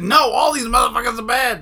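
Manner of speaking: angry outburst. Real, not acted. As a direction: snap back in real annoyance. Heated rant about politicians.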